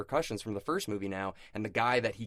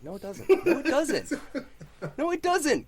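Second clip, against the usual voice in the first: high, insistent comic outburst; exasperated protest; 7 dB louder than usual. Real, not acted. Co-host rebutting his friend's Marvel pitch, exasperated. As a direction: keep protesting, getting louder. Heated mock argument over Marvel films.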